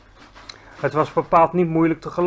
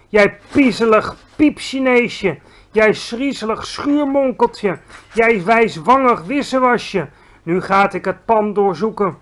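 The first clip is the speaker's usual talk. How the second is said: shouted insults; 4 semitones higher, 5 dB louder than usual. A narrator's reading. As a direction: spit out each insult, drawing it out. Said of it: "riep hij".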